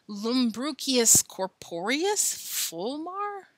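high, amused sneer at fake Latin; mocking disbelief. Father teases the boys about the fake medical words, high and slow. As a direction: savour the silly words, slow and incredulous.

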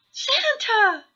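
put-on very high, loud childish call; little hare squealing the name; delighted cry. A narrator's reading. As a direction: cry out to Santa in excitement. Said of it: "cried Baby Hare".

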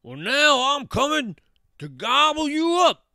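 loud, high roared threat; troll roar. A narrator's reading. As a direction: roar that you will devour them. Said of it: "roared the troll".

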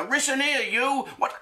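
high mock ambassador accent; mock Chinese ambassador. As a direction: bark orders at the spies in a put-on accent. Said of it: "Chinese ambassador speaking to his spies".

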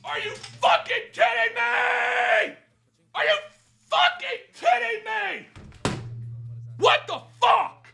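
enraged howl; real furious scream. Real, not acted. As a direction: scream it out in disbelief at the loss. Losing a big multiplier.

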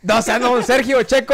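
loud, high outburst of disbelief; real astonishment. Real, not acted. Host learns guest knows his friend, astonished. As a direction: blurt it out, amazed at the coincidence.

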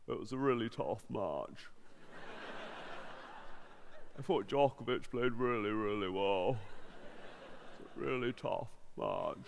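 very low, quiet monotone; deadpan, subdued sportsman in a low mumble; Andy Murray impression. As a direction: drone it softly, deep and dull. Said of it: "I can do Andy Murray".

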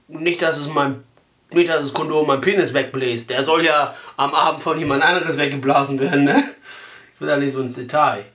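ordinary delivery

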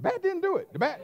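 incredulous mock rebuke; mock scolding. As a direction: scold the batter, stress didn't. Mocking rebuke.

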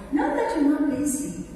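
normal talking voice

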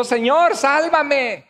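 high, drawn-out plea; acted cry for help. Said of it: "empezó a hundirse y gritó".

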